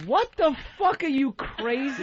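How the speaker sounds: stunned outburst; shocked. Real, not acted. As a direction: yelp out in disbelief. Shocked reaction to gifts.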